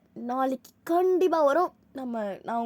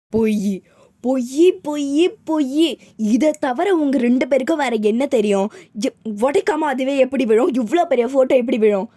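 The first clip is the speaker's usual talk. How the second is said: loud angry yelling, calling them liars; enraged mother shouting liar; furious shout; 12 dB louder than usual. Acted. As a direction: blast the kids with accusations. Mother's rant about broken photo.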